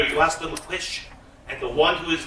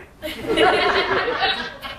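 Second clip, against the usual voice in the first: a real burst of laughter; laughing aloud; 12 semitones higher than usual. Real, not acted. Storyteller laughing at her own tale. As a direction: laugh at the joke.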